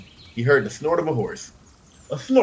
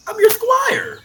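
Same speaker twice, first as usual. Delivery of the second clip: put-on much higher young boy; boy's voice; 9 dB louder, slower than usual. A narrator's reading. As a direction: play the eager little squire. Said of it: "the boy said".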